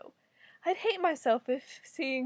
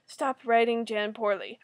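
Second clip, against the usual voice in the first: ordinary delivery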